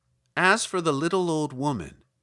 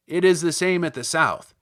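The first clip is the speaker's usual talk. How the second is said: louder little Munchkin voice; 2 semitones higher, 8 dB louder than usual. Acted. Munchkin tells Dorothy about the shoes, louder. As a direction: speak up like an eager small villager.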